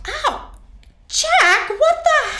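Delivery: high startled outrage; yelp, then demand an answer; indignant yelp. Acted. Hand slapped away.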